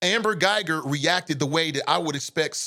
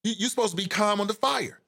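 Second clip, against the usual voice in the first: normal talking voice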